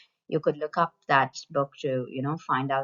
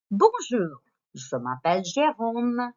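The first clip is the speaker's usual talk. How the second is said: higher, louder voice for the toy Jérôme; puppet character voice; 6 semitones higher, 4 dB louder than usual. Acted. Teacher voices the toy introducing himself. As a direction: give Jérôme his own cheerful voice. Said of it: "what is this one's name".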